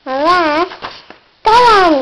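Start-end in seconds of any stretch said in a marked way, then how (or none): 1.4-1.9 loud, high shout. Acted. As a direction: shout the order out. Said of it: "he shouted".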